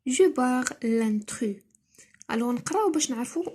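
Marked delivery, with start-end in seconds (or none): 0.1-1.6 very slow reading. Shown as a demonstration. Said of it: "reads the instruction very slowly".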